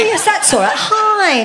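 high, loud, laughing hello; teasing high sing-song hi; jokey greeting. Real, not acted. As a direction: greet him with mock delight. Greeting old man playfully.

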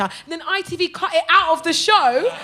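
normal talking voice

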